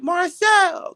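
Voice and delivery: high, called-out imitation of coworker; high-voiced mimicked call. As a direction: holler the name in her high voice. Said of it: "she came to me, said".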